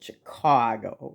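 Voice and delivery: Chicago with a rounded aw, as if a W were in it; Milwaukee-style Chicago, middle vowel rounded to aw. Retold or imitated. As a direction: say Chicago as Chi-CAW-go. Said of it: "like, with a W".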